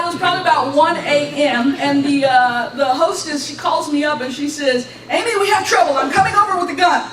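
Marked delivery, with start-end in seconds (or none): 5.1-7.0 louder, higher, hurried call; urgent alarm. Retold or imitated. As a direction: imitate a hostess phoning in alarm. Hostess's urgent phone call retold.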